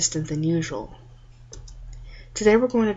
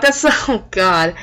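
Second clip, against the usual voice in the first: laughing; 7 dB louder, slower than usual. Real, not acted. Laughs at the scene.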